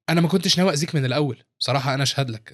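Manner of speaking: ordinary delivery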